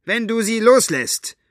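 high, defiant stand; determined. A narrator's reading. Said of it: "Mit entschlossener Miene".

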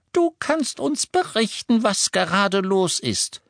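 put-on high, slow elderly lady voice; a no-nonsense grandmother asking; brisk old lady. A narrator's reading. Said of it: "sagte sie forsch".

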